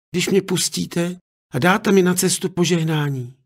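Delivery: a daughter persuading with a made-up dream; girl telling a dream. Acted. Daughter tells invented dream.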